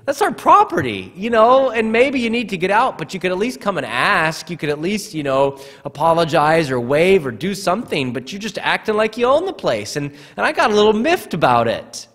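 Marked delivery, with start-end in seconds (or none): 0.1-9.9 higher-pitched, mildly exasperated complaint; irritated. Retold or imitated. Pastor recalling his gripe at neighbors crossing his yard, irritated. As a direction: retell an annoyed homeowner's protest. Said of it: "I was getting a little irritated".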